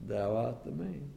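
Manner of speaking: low, soft grave accusation; God quietly naming the guilty; solemn divine verdict. Acted. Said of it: "And God says".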